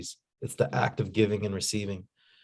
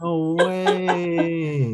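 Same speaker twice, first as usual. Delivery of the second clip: amazed disbelief; 7 semitones higher, 4 dB louder than usual. Real, not acted. Reacting to the photo.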